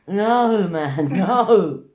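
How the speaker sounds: loud drawn-out "no, man"; exclaimed mock-dismayed refusal; retold protest. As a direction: protest loudly, stretching each no.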